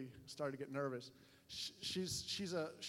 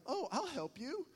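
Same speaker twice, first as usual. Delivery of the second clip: high, sing-out helpful offer; mock innocent eagerness, pitched up; eager naive helper; 8 semitones higher than usual. Tourist offering to find stranger's glasses, exaggerated eager helpfulness.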